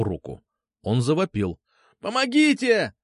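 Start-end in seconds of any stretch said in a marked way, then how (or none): 2.0-2.9 high-pitched squealed cry for help; squealing. A narrator's reading. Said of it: "завизжал он". (визгливый крик о помощи)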